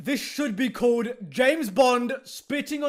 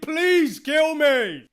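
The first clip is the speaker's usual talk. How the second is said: slow, drawn-out, louder plea; mock despair; 3 semitones higher, 4 dB louder, much slower than usual. Critic begging to be spared this film, mock despair. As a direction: wail it in exaggerated exasperation.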